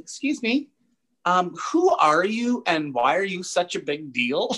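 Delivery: put-on high, slow girlish voice asking sweetly; curious girl. Girl behind them asking who Hartnell is, high and slow. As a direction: play a puzzled young woman leaning in. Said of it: "And she's like".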